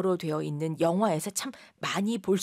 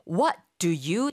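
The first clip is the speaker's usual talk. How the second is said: slow model phrase; 3 dB louder, much slower than usual. Shown as a demonstration. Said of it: "다음에".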